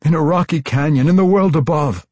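high, loud, anxious answer; frightened explanation. A narrator's reading. Tosin explains to the threatening matron, frightened. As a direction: blurt it out pleadingly.

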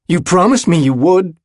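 high, loud accusation; angry reproach. A narrator's reading. Willem reminds his friend of a promise, angry. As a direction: hold him to his promise.

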